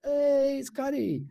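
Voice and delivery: high sing-song cooee call across the paddock; bush coo call. Retold or imitated. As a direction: call out long and high to the boy. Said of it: "I cooed out".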